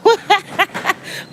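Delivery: a high burst of laughter. Real, not acted. Laughing high at Lincoln's comment on bleach stains.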